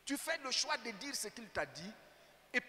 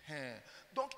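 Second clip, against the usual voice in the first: ordinary talk, nothing marked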